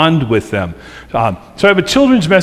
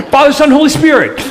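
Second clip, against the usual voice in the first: higher, louder and fast, laughing through the blessing; laughing through the words; 11 semitones higher, 4 dB louder, faster than usual. Real, not acted. Pastor reliving the baptism, laughing as he rushes the words. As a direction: rattle off the formula while cracking up.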